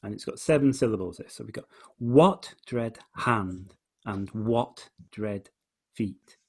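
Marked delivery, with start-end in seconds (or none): 2.0-3.8 slow, heavy beat on "what"; very slow line, "what" stressed and set apart; stress on first beat. Shown as a demonstration. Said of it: "he's stressing the, the, the first beat". 4.3-6.4 "what" stressed, then "dread", pause, "feet"; measured beats led by "what"; stress on first beat. Shown as a demonstration. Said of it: "he's stressing the, the, the first beat".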